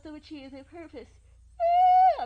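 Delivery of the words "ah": loud cry. Acted. Sudden outburst before "not yawning.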